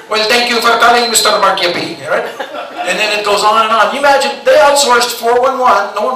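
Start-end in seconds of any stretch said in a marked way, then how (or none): 0.1-2.0 put-on call-centre agent with Filipino accent; even, formal script in a put-on Filipino accent. Tech support agent greets caller, Filipino accent. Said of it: "it's the Filipinos".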